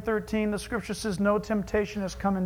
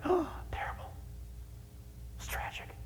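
whispered, mock-pitying sigh of a judgmental churchgoer; mock sympathy; 7 semitones higher, 13 dB quieter than usual. Church folk gossiping about a sinner, mocking whisper. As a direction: breathe it out like gossip in a pew.

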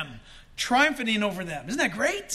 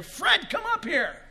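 summoning call; 9 semitones higher, slower than usual. Acted. Preacher imagines a listener called at the rapture, high summons. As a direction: call him up from afar. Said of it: "you're gonna hear".

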